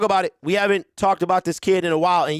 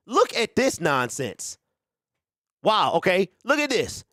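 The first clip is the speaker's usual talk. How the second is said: normal talking voice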